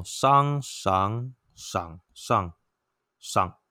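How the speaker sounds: 商 赏 上 each syllable sung through its own tone; Mandarin tone demo. Shown as a demonstration. Teacher showing students the tones, slow and deliberate. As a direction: glide 商、赏、上 through the tones one by one. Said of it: "像国语呢".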